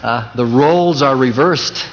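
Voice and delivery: tripping over "roles are reversed"; false starts, then a careful recovery; tongue-tied stumble. Real, not acted. Preacher stumbling over his own sermon line, tongue-tied. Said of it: "that was a tongue twister".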